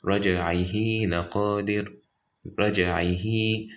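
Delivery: "رجعه" with a long held hee at the end; stretched recitation. Shown as a demonstration. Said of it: "you have to stretch it".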